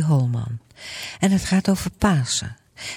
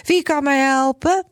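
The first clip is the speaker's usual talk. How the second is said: much higher pleading call; Grietje calling out; 9 semitones higher than usual. Acted. Grietje calling for help, much higher and pleading. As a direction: cry out for someone to help. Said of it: "die roept weer".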